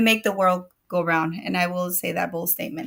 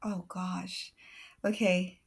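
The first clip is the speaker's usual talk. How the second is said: ordinary talk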